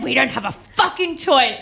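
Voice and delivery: high, strained mock yell; retold screaming. Boyfriend insisting they must hitchhike, mock screaming. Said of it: "we were, like, screaming".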